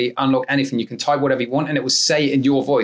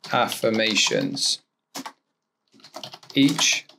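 slow dictation; 2 semitones lower, much slower than usual. Acted. Dictating prompt to software.

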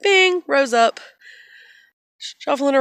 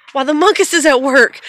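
much louder and higher; playful loud repeat; 5 semitones higher, 10 dB louder, faster than usual. Pretend. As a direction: boom it out in mock announcement. Same words repeated, boomed out playfully.

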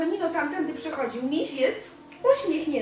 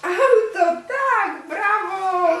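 higher, much louder bravo; real excited cheer; cheering praise; 8 semitones higher, 6 dB louder, much slower than usual. Real, not acted. Praising child's word.

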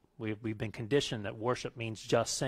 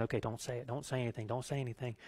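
quiet self-restraint; 2 semitones lower, 8 dB quieter, faster than usual. Retold or imitated. Restraining himself in the car.

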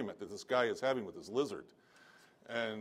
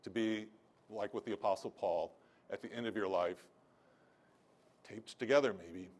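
ordinary talk, nothing marked

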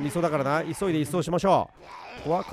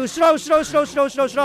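alarmed repeating; 7 semitones higher, 7 dB louder than usual. Real, not acted. Zombies coming from behind.

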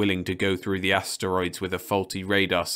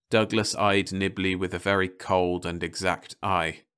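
usual speaking voice

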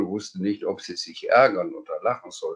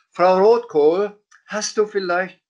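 calling out; 11 semitones higher, 6 dB louder than usual. Acted. Sams calls on housekeeper to ask.

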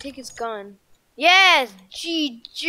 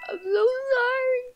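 mock crying; 11 semitones higher than usual. Apologising to the lost pet.

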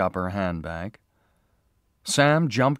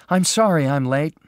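called out higher and louder as she hurries in; calling out; 7 semitones higher, 7 dB louder than usual. A narrator's reading. Marion hurrying into the office, calling out her apology. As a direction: call the apology across the office. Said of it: "Marion called".

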